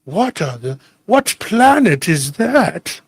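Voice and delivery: very slow, exaggerated mock disdain; hammed-up snooty incredulity; disdainful. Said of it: "He says it with this disdain".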